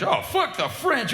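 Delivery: high, slow sneer; mock English sneer; mock dismissive. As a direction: sneer at the French. Mocking English people.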